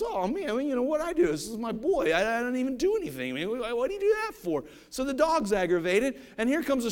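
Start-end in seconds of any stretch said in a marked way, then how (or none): 0.0-4.7 lightly voiced dog, higher; a hint of a hurt, puzzled dog; poor dog. Dog complaining to the boy who kicked him, lightly voiced higher. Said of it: "This poor dog goes".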